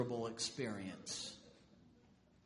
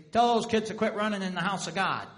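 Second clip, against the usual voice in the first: put-on louder, faster scolding at the kids; stern scolding church voice; 3 semitones higher, 10 dB louder, faster than usual. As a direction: snap at the children like a stern elder. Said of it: "it might say".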